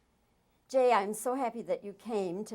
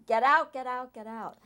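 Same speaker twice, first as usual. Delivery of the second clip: urgent mock yell, higher and much louder at the start; retold yell; 5 semitones higher, 10 dB louder than usual. Interviewer imitates director yelling warning to everybody on set, retold yell. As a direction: imitate a warning yell, easing off on the last one. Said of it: "had to just yell to everybody".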